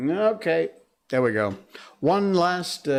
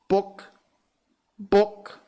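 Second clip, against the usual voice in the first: higher clucking book, book; chicken voice; 9 semitones higher than usual. Acted. Chicken tossing books to the frog, higher clucking.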